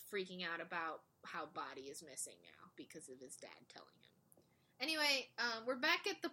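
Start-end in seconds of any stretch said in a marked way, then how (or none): 0.1-4.1 whispered aside. Real, not acted. Hushed retelling.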